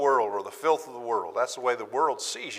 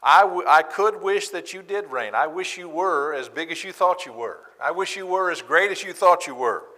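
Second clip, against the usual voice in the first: sarcastic; 6 semitones higher, 4 dB louder than usual. Acted. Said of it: "Paul is sarcastically throwing that right back".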